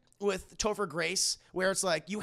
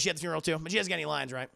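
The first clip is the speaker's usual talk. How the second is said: ordinary talk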